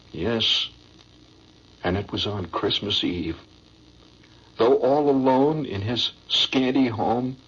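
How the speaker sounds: low, slow, sentimental recital; poem recitation. Acted. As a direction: recite it like a heart-tugging stage ballad. Recites the old Christmas poem.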